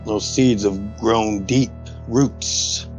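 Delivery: ordinary delivery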